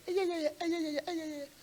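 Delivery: high, soft mock chatter; chattering wife. Wife gossiping with other couples at retreat, mock chatter. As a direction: imitate a woman babbling away with friends. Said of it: "istrinya lagi ngomong sama rekan-rekan Pasutri".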